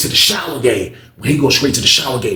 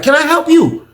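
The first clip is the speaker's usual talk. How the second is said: high, put-on lady guard voice; 5 dB louder than usual. CO lady asks the inmate what he needs, high. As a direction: play the CO asking from the desk, pitched high. CO repeats the question.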